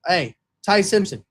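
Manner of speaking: lightly voiced tough coach; a hint of a coach calling a player over; Hey Weirdo bit. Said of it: "the Hey Weirdo".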